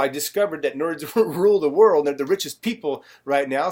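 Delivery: ordinary talk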